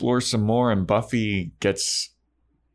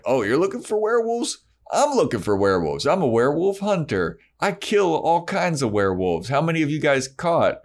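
lightly voiced swaggering hunter, pitched up; a hint of a boastful creep; creepy hunter voice; 8 semitones higher than usual. Recapping the hunter sneering at Giles and Buffy, mock boastful.

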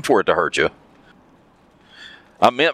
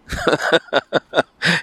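short run of quick chuckles; 5 semitones higher than usual. Real, not acted. Commenting on a cheater's non-apology, a quick chuckle. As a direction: chuckle to yourself mid-remark.

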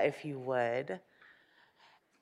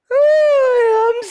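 much higher, louder tiny elf; little elf voice; 10 dB louder than usual. Acted. Sleepy elf answering the rag dolls, high piping.

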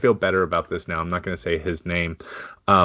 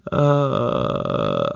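wheezy laugh; 5 semitones higher than usual. Real, not acted. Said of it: "I wish I could stop laughing like an old man".

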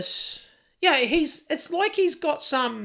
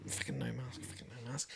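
much softer, low hushed grumbling; barely audible mutter meant not to be heard; muttered under breath; 12 semitones lower, 22 dB quieter, faster than usual. Retold or imitated. Worker grumbling under his breath about no mask, muttering. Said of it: "muttering under his breath".

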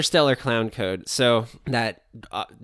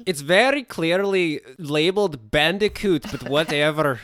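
put-on Russian accent; 6 semitones higher than usual. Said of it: "This is becoming Russian".

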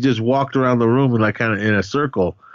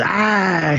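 long high mock scream; retold scream of electrocution; imitated scream; 10 semitones higher than usual. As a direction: draw out a high "ah" without real volume. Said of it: "he starts screaming".

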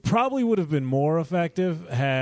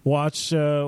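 ordinary talk

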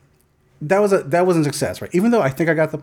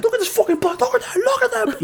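high-pitched frantic mock yell; retold outrage, squealed rather than shouted; furious. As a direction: jab at it repeatedly, voice pitched way up. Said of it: "I was just so fucking furious".

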